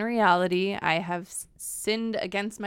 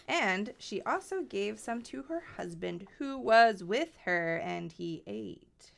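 measured read-aloud voice, softer; scripture reading; 3 semitones higher, 5 dB quieter than usual. Acted. Reader reads Genesis aloud to the co-host, measured reading. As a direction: read the Bible passage out with care.

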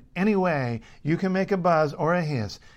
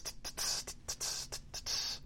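whispered tss hi-hat beats; crisp hissing cymbal taps; 17 dB quieter than usual. Shown as a demonstration. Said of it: "you'll naturally go tss".

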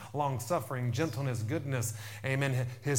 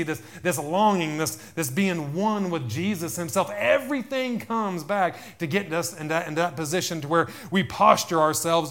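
raised, louder pulpit voice; impassioned preaching; 5 semitones higher, 8 dB louder than usual. Real, not acted. Preacher urging church toward intimacy with Jesus, impassioned. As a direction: preach it with mounting force, higher and louder.

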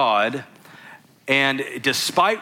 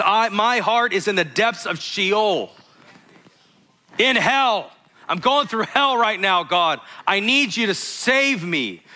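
high, pleading cry to God; desperate cry; 6 semitones higher than usual. Acted. David telling God he is going through hell, desperate cry. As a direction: cry out from the depths, pitched well up.

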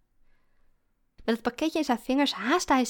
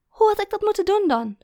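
loud, high-pitched challenge; raised, scoffing question; incredulous; 11 semitones higher, 7 dB louder than usual. A narrator's reading. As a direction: press him for an answer in disbelief. Girlfriend repeats the incredulous challenge.

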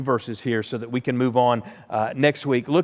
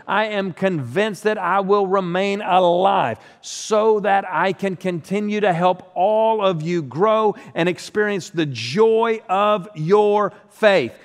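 slow, raised, earnest reading of the verse; scripture reading; 6 semitones higher, 4 dB louder, slower than usual. Acted. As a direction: read it like the living word, slow and full. Reads scripture aloud.

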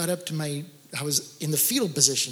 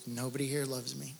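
hushed lonely line; 3 semitones lower, 11 dB quieter than usual. Retold or imitated. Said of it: "to say".

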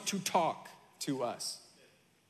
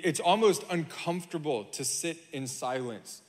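usual speaking voice

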